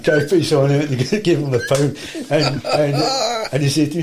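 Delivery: words breaking into laughter; amused, laughing storytelling; laughing while talking. Real, not acted. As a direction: tell it through real laughs. Laughs retelling the pull-up contest.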